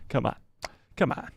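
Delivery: ordinary talk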